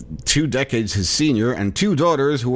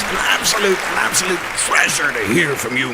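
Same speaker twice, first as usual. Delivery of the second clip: mock fawning; 7 semitones higher than usual.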